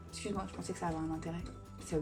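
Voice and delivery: ordinary delivery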